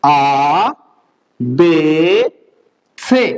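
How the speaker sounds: आ, बे, से held long one by one; low, drawn-out letter names आ, बे, से; German alphabet recital. Shown as a demonstration. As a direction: model आ, बे, से slowly for learners to repeat. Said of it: "मेरे साथ साथ दोबारा बोलिए".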